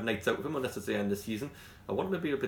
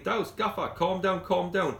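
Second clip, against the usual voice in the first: higher-pitched mock plea to settle down; imitated placating player; 8 semitones higher, 3 dB louder, slower than usual. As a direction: voice a player trying to calm the gaffer. Voices player pleading with angry manager.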